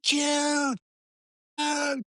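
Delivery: high calling shout across the street; fan shouting a name. Retold or imitated. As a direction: call out to a star across the road. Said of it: "suddenly someone in the crowd goes".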